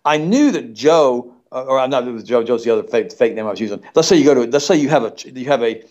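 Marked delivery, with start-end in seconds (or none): none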